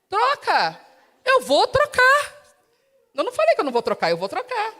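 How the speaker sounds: a husband nagged, snapping back in exasperation; irritated husband. Acted. Acted quarrel over the shower. (marido irritado, mais agudo e alto)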